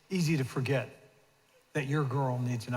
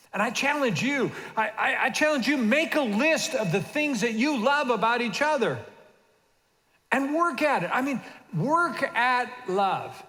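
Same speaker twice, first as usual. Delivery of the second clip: raised, emphatic preacher's call; preaching exhortation; 7 semitones higher, 4 dB louder than usual. Acted. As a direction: urge the congregation, building.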